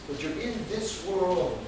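ordinary talk

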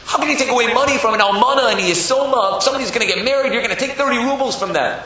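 high, outraged scolding; yelling rebuke. Acted. Rav berating a man who kept a widow's money, yelling. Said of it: "started yelling at him".

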